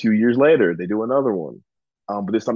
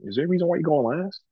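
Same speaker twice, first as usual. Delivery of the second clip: higher, quick imitation of a gruff veteran; retold challenge to the last guy, a touch higher; mock Bully Ray; 7 semitones higher than usual. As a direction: lightly mimic the veteran questioning a rookie. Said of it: "Bully stopped and goes".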